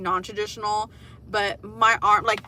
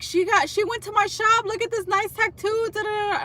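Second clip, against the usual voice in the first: gushing stranger; 11 semitones higher than usual. Retold or imitated. Mocking the stranger squealing over the tattoo artist, high excited. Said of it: "And it's like".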